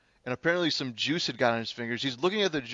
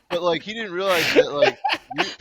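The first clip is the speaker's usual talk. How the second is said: laughing; 7 semitones higher, 6 dB louder than usual. Real, not acted. Laughing through the story.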